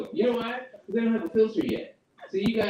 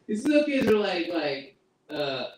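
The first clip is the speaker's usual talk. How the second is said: normal talking voice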